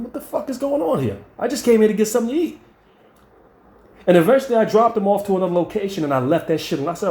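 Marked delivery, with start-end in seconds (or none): 0.0-2.6 high, disbelieving outburst; high-pitched what-is-going-on disbelief; incredulous outburst. Retold or imitated. Driver baffled by the couple, high incredulous outburst. Driver's outraged retold thought.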